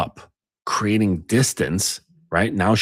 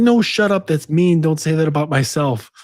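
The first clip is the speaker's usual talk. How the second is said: much higher and louder mock protest; indignant inner voice; 8 semitones higher, 5 dB louder than usual. Her defensive inner voice shouting down the critic, high and loud. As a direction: squeal back at the inner critic. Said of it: "the other part does is like".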